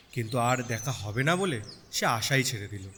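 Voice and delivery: ordinary delivery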